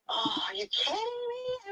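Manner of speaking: high, slow, choked-up happy cry; thrilled disbelief. Retold or imitated. Told by the Lord she can leave, crying with joy. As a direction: squeal it like the best news ever. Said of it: "I was like".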